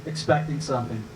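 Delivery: usual speaking voice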